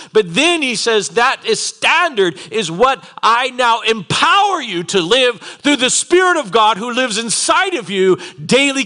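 high, loud, driving sermon; impassioned preaching. Real, not acted. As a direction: preach it at full force, hammering key words. Preaches at full force.

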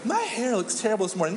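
quick, put-on self-pity; fishing for compliment. Compliment-seeker complaining to friends, mock self-pity. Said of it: "who's always fishing for a compliment".

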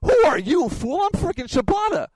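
very high, loud, cocky yell; indignant shout. Retold or imitated. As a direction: shout your own name like a champion. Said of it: "in my head, fool, I'm thinking".

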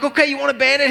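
high, strained offer; acted-out frazzled dad trying to placate; desperate parent offering. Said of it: "I'm like".

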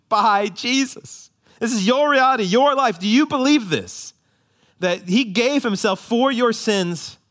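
high, loud pleading question to the church; impassioned preaching. Acted. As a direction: press them to believe it.

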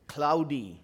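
ordinary talk, nothing marked

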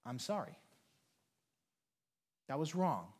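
soft, quiet, heartfelt apology; sincere apology. Real, not acted. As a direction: say it gently, as a real apology. Pastor apologizing to the hurt.

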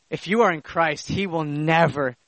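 low, softening reassurance with pauses; tender assurance. Real, not acted. Preacher reassuring the believer in Christ, tenderly hushed.

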